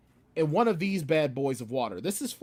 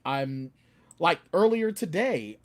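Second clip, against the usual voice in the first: usual speaking voice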